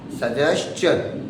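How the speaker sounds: slow सजेशन with a ch, "suh-jesh-chun"; correct pronunciation. Shown as a demonstration. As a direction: draw out सजेशन, sounding the ch. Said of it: "फिर भी चा पढ़ रहे हैं".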